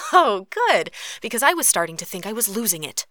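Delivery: exaggerated relief, easing into a quick patter; mock relief. A narrator's reading. Katori hearing vampires don't exist, mock relieved.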